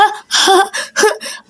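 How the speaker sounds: a quiet, breathy giggle; soft giggle. Acted. Girls laugh at the made-up friend, soft giggle. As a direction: giggle at the friend's painted face.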